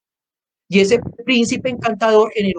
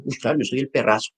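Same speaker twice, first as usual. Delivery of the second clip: low, quiet, pleased with himself; smug flattered man; 5 semitones lower, 5 dB quieter than usual. Retold or imitated. Man flattered at being called perrazo, lightly voiced, low and soft. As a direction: lightly play the proud man who takes it as praise. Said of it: "se va a sentir halagado".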